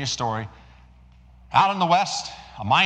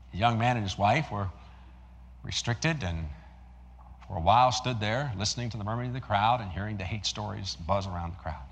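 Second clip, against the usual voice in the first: ordinary talk, nothing marked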